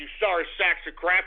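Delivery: usual speaking voice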